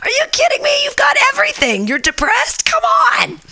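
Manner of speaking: high-pitched exasperated yelling; retold yell. Comic retelling how she told off a complaining colleague, yelling high. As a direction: reenact yelling at her in disbelief. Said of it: "I yelled at her".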